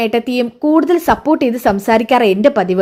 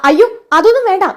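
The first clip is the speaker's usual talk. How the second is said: alarmed protest; 8 semitones higher, 3 dB louder, faster than usual. Acted. Brother panics at threat of truth.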